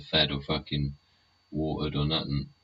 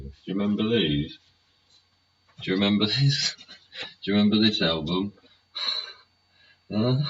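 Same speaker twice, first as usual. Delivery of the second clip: excited; 6 semitones higher, 4 dB louder, faster than usual. Real, not acted. Showing off old vinyl records.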